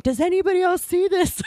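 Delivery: high-pitched mock astonishment; acted-out incredulous outburst; amazed blurt. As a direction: pipe up high, as if asking the whole room. Acting out urge to blurt out.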